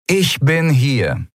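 slow, calm announcement from behind; commander's voice. Acted. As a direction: commander stepping in, slow and sure. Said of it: "die tiefe Stimme seiner Kommandantin".

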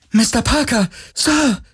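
hoch und jubelnd gerufen; kindliche Freude. Acted. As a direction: ruft begeistert wie ein Kind. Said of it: "rief ... mit fast kindlicher Freude". (Kowalski ruft Parker freudig zu, hoch und jubelnd)

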